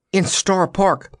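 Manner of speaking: usual speaking voice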